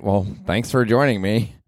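ordinary delivery

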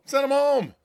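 high, loud and slow dismissal; loud rant. Real, not acted. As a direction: send them off in disgust. Ranting at the government shutdown.

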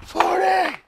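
high straining final count; effort shout. Real, not acted. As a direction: push out the last rep with effort. Final rep of the set.

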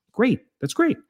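high-pitched, quick mock approval. Answers an imagined churchgoer's report.

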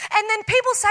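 ordinary talk

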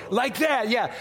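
ordinary talk

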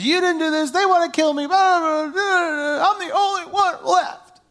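high, whiny, crying complaint; whining Elijah. Retold or imitated. Elijah whining to God that he's the only one left. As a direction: whine it out like a sulking child. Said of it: "He starts whining to God".

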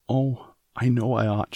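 a mild distressed cry; anguished cry. A narrator's reading. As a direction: cry it out in regret. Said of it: "she cried".

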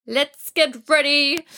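a hint of an inner alarm calling you to get ready; body's warning voice. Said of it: "It's saying".

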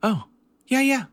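high mom voice settling down; relieved and high, easing off; calming mom. Retold or imitated. Mom on the phone calming down, high relieved. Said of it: "slowly calming down".

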